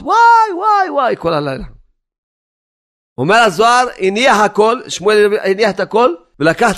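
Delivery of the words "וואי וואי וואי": repeated high wails, falling away; crying out. Acted. Prophet cries to God all night, high repeated wails. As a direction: wail high again and again, starting strongest. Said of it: "שמואל הנביא כל הלילה צעק".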